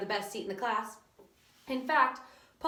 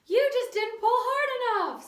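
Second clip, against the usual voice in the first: high, louder, slow little voice; pigtail voice; 13 semitones higher, 7 dB louder than usual. A narrator's reading. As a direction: scold him gently for being weak. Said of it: "said the pigtail".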